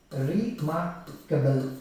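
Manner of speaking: REMARKABLE slowly split as re-mar-ka-ble; drawn-out remarkable, one syllable at a time. Shown as a demonstration. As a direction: break remarkable into four slow beats. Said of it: "four syllables".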